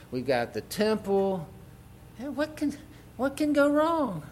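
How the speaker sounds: high, careless, shrugging voice of smug believers; complacent attitude. Retold or imitated. Preacher mimics complacent Judah, high careless shrugging voice. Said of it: "Very complacent attitude".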